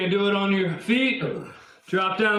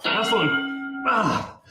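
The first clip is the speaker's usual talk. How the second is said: shaking with effort; much slower than usual. Real, not acted. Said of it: "My arms are shaking".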